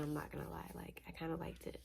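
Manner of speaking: sheepish whispered confession. Real, not acted. Admitting to the viewers she liked his persistence, whispered. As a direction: admit it under your breath.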